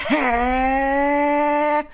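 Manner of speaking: very high, loud sustained yell; loud high warrior yell; drawn-out battle shout. Acted. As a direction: hold one long anime power-up cry. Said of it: "He shouted as his abs expanded".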